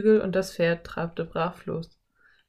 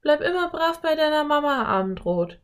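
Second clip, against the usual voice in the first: put-on high girlish farewell call; 9 semitones higher than usual. A narrator's reading. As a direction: call to the foal in a high girl's voice. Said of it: "rief Anne".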